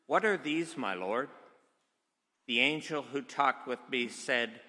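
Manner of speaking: normal talking voice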